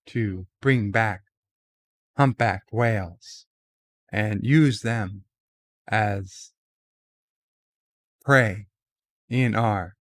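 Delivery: halting, dramatic pauses between phrases, lightly voiced Shatner; a hint of Captain Kirk, word by word with pauses; William Shatner impression. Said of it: "to do his Shatner impression".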